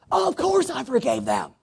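high, airy, too-quick reply; mock breezy denial. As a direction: play it light and falsely innocent. Mocking the self-justifying person.

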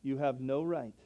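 usual speaking voice

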